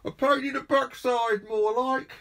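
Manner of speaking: high mocking neighbour voice; neighbour shouting. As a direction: jeer it back across the street. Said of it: "shouted the voice from across the street".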